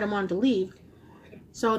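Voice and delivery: muffled behind fingers; speaking through hand. Real, not acted. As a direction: hand over mouth. Seen 0: Hand pressed over mouth from 0.8 to 2.4 s, mid-phrase.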